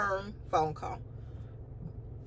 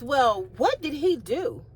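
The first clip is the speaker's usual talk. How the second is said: mocking receptionist; 4 semitones higher, slower than usual. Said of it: "she says".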